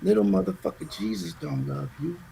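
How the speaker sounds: hushed, voiceless taunt from the dark; mysterious voice. Retold or imitated. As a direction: whisper it like an unseen demon. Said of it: "and the voice says it".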